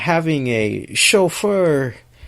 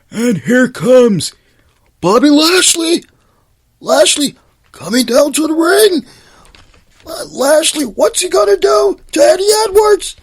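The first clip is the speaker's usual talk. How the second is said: Josh Matthews impression; 13 semitones higher, 5 dB louder than usual. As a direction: do the announcer call properly, hamming every name. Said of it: "I gotta do this right".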